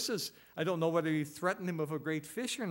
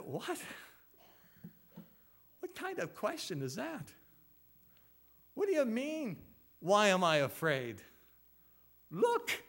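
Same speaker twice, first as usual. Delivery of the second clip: mock indignant disbelief, pitched higher; incredulous; 5 semitones higher than usual. Disciples answering Jesus in storm, mock incredulous protest. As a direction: act the disciples' stunned protest.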